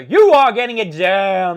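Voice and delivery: slow, booming announcer proclamation; announcer verdict. Acted. Host thanking the helpful stranger in a booming announcer voice. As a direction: boom it out like a game-show host.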